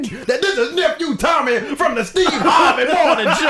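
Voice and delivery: high, drawn-out show reveal; Nephew Tommy. Retold or imitated. Prank caller revealing the radio show, high and drawn out. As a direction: announce it slowly in a prankster's high voice. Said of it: "in the same voice".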